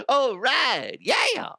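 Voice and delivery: very high, loud clown squeak. Acted. Host hunts for his clown voice, squeaking high. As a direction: push it up into a cartoon clown squeal. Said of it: "That's not a good clown voice".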